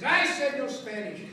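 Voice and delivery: loud, slow imitated reprimand; teacher yelling. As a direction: drive it home as the scolding teacher. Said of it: "Don't be yelling at me".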